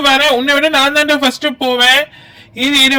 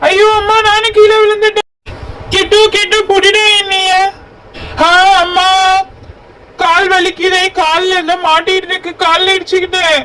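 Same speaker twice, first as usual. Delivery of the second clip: pained cry; 5 semitones higher than usual. Acted. Boy falling down the hill cries out in pain to his friend. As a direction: yell out, hurt and scared. Boy falls and hurts leg.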